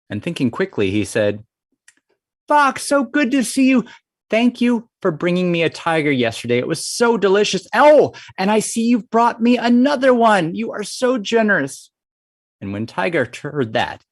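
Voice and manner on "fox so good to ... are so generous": high-pitched, delighted frog voice; gushing Frog. Acted. Frog thanks Fox for bringing a tiger, high and gushing. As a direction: gush with showy gratitude to scare off a rival.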